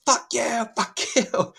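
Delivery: high-pitched mock scream, drawn out; imitated screaming. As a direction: imitate a woman screaming at staff to back off. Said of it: "around her screaming".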